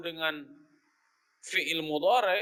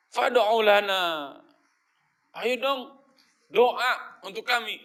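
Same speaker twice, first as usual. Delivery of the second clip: a touch of bossy, cocky pleading, higher and louder; cocky demand; 4 semitones higher, 6 dB louder, slower than usual. Retold or imitated. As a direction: demand it like you're owed. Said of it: "Ini songong ini". (nada menyuruh yang songong, agak tinggi dan keras)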